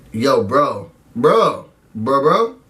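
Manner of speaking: low, repeated bro chant; bro talk. Retold or imitated. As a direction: lightly mimic two bros hyping. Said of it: "Winston goes to Brett".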